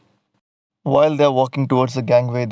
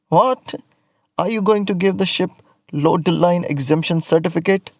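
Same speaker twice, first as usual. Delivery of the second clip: surprised; 5 semitones higher than usual. Acted. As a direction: act baffled by the question. Painter reacts to inspector's ban.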